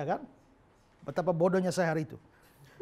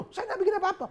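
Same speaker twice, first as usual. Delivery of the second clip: shocked protest; 14 semitones higher, 5 dB louder, faster than usual. Retold or imitated. Protesting to the bodyguard.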